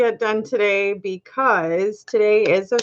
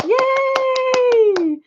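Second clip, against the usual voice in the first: excited cheer. Real, not acted. Cheering a viewer's pregnancy news.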